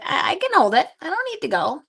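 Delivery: high-pitched voice for a reluctant pet; Whiskey the dog. Acted. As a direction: speak up high as the dog insisting. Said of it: "He's like".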